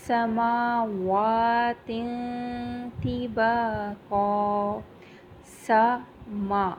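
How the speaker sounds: سماوات with a hissing س and a long ما; slow سماوات, hiss the س, stretch ما; whistling seen. Shown as a demonstration. Teacher demonstrating the whistling س in سماوات. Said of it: "یہ سٹی کی ساؤنڈ آئے گی".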